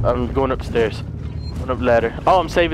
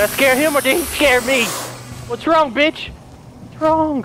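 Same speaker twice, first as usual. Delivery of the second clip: higher, mocking jeer at the enemy; taunting; 7 semitones higher than usual. Real, not acted. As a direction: goad him like a bully. Taunting the alien.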